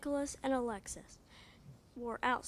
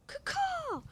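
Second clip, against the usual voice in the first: high squawking bird call, kaw kaw; hawk cry. Acted. Child reader squawks the mama hawk's call. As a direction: screech it like a hawk.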